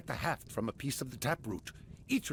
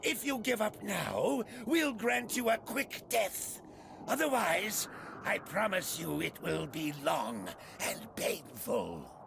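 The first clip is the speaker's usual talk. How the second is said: menacing ultimatum; 6 semitones higher than usual. Acted. Commander offering the enemy surrender terms, menacing. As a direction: promise a painful end, coldly.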